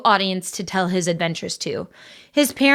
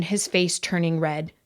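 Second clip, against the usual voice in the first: ordinary delivery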